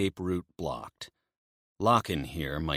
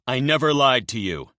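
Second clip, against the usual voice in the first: defiant; 7 semitones higher, 8 dB louder than usual. Acted. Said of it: "spitting blood".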